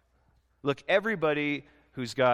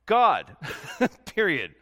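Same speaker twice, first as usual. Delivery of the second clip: emphatic; 2 semitones higher, 5 dB louder, slower than usual. Acted. Preacher's emphatic answer.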